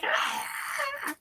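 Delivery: breathless little whimper; barely voiced yelp; soft whimpering cry. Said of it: "he's like".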